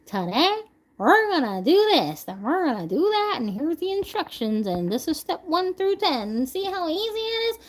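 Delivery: chirpy, high-pitched tutorial host; mock-perky instructor listing the steps; so organized. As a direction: present it in a bright, singsong demo voice. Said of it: "I'll be so organized".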